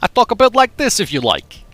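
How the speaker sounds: high, loud New York voice; New York accent. Shown as a demonstration. Streamer offers chat a New York accent. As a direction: talk like a New Yorker. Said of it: "talk like I'm from New York".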